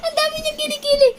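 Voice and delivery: high, loud, laughing out the words; cracking up while exclaiming; laughing exclamation. Real, not acted. Laughing at armpit hair. (natatawang pagsigaw)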